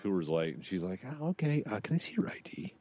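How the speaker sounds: ordinary talk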